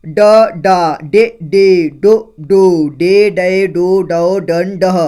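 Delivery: each vowel called out loud and clear, with pauses; loud syllable drill. Shown as a demonstration. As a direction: recite the vowels slowly for the class. Barakhadi recitation. (ज़ोर से धीरे-धीरे स्वर बोलना)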